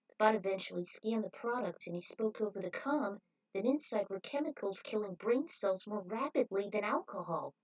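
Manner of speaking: usual speaking voice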